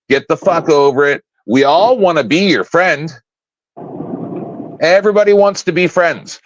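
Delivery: usual speaking voice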